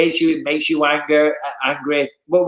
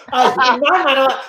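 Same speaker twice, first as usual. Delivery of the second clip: ordinary talk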